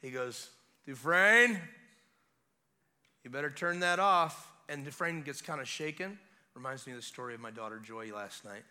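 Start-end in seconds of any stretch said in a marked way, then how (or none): 0.9-4.5 raised-voice imitation of a guard; guard's command. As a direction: call it out firmly like a guard. Voices guard shouting through locked door.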